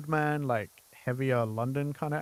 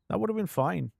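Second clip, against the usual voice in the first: normal talking voice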